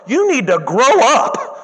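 drawn out, firm scolding; slow stern rebuke. Real, not acted. Preacher scolding cheesy teenagers, slow and firm. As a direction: lay it down slowly like a scold.

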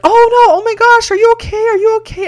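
very high, loud mock panic; hammed-up, shrill alarm, way up high; imitated panicked freak-out. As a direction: squeal it like someone flustered and freaking out. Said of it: "kind of freaks out a little bit".

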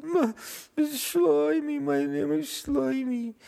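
put-on very high, loud whining child voice; hammed-up tearful wail; crying boy. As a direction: bawl it out like a tantruming little boy. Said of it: "the boy was still screaming and crying".

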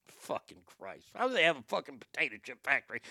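ordinary talk

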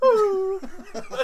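high, loud wail breaking into laughter; hammed-up fake sob; mock crying. Friend asks how she cried, loud mock crying. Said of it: "Wait, how did she cry?".

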